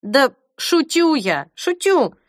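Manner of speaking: slow, rising high "шучу" over and over; just kidding, pitch shooting up; hasty backpedal. A narrator's reading. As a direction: quickly take it back, voice climbing. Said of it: "поспешно говорит".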